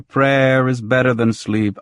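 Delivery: plain reading voice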